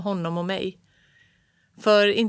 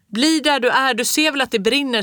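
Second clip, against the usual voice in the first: loud, high alarmed shout; 6 semitones higher, 6 dB louder than usual. A narrator's reading. Father shouts at son to stay back, alarmed yell. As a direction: yell the warning at the boy. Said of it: "skrek pappa".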